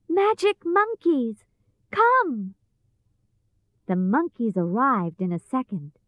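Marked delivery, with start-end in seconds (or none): 0.1-2.6 put-on high girl's summons; calling out. A narrator's reading. Dorothy summons the magic monkeys, calling out. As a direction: call them to you, pitched high. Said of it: "and called".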